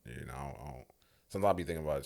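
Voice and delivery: normal talking voice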